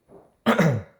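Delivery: sudden harsh cough; loud cough. Real, not acted. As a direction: cough hard into the mic. Loud cough mid play.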